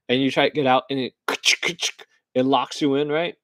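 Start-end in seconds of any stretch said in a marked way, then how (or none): none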